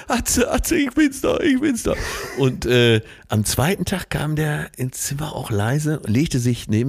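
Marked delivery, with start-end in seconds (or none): 0.1-1.9 high, strangled mock panic; hammed-up panic, much higher; panicked plea. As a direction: squeak out a desperate plea while pinned to the wall. Friend pleads while being choked.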